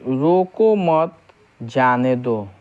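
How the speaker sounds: slow "रोको मत", break, then let "जाने दो" fall; pause after मत. Shown as a demonstration. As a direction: say "रोको मत" as one phrase, pausing before "जाने दो". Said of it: "जो अल्प विराम चिन्ह है".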